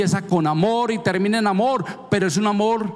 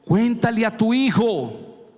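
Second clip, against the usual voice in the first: preaching; 7 semitones higher, slower than usual. Acted. Preaching God's command.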